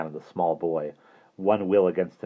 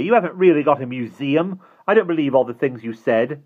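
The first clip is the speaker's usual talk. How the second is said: put-on higher, louder child retort; defiant; 8 semitones higher, 6 dB louder than usual. A narrator's reading. Child challenges Mister Hastings's claims, defiant. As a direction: throw disbelief back at the villain. Children defy villain in story.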